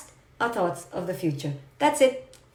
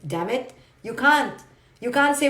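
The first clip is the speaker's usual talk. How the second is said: ordinary talk, nothing marked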